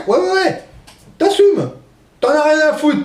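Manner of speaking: mocking voice. Continues mocking old voters.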